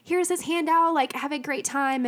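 chirpy hello at the door; cheerful greeters. Retold or imitated. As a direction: mimic overly happy church greeters. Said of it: "They're like".

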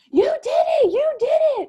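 very high, loud, joyful yell; excited screaming. Retold or imitated. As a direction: scream it in triumph at the new mother. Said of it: "he just starts screaming".

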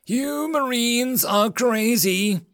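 high, slow shout; shouting over wind. Acted. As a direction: call it from the back seat. Reply shouted from the back.

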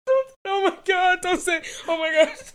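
high, loud teary laughter breaking into words; laugh-crying, begging mom to stop; laughing through tears. Real, not acted. Daughter to mom during goal talk, laughing and crying loudly. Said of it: "I'm gonna cry".

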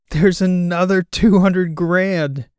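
loud, slow, stunned cry; excited shout. Acted. Wes shouting at the donation on screen, stunned loud cry. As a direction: shout it out in disbelief.

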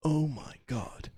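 soft, hushed fake awe; quiet, self-important reverence; mock moved filmmaker. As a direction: confide it as if overwhelmed by your own footage. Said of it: "the whole time they're editing".

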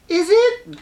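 very high put-on girly voice; exaggerated high-pitched girl. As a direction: squeak it like a cartoon girlfriend. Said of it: "stereotypical girl voice".

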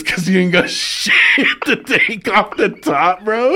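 words stretched out by laughter; cracking up. Real, not acted. Retelling a friend's barbershop roast, cracking up. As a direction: struggle through the punchline laughing.

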